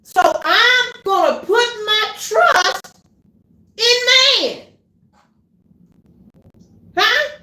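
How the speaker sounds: loud, high shouted preaching; preaching at full force. Acted. Preacher crying out Jeremiah to the congregation, loud and high.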